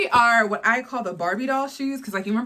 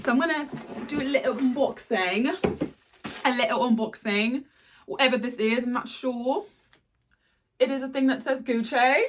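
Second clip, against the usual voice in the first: lightly put-on higher unboxing voice; a playful, slightly higher presenter voice for the unboxing; odd put-on voice; 4 semitones higher than usual. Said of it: "I don't know why I'm talking like this".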